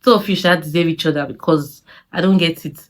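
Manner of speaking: normal talking voice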